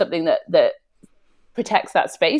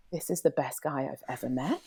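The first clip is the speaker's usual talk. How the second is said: much softer, lower inner voice; hushed awe; 5 semitones lower, 11 dB quieter than usual. Retold or imitated. Woman recalling her thought about a lover, in hushed awe. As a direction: think it quietly to yourself, in mock wonder.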